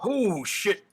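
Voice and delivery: high, loud startled yell. Acted. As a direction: yell it out in alarm, drawn out. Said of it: "Hess is gonna yell out".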